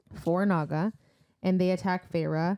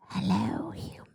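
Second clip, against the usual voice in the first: creepy hissed whisper; snake monster whisper; 4 dB quieter than usual. Pretend. Host trying out the Naga voice, creepy whisper. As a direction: whisper it like a lurking snake creature. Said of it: "Was that good?".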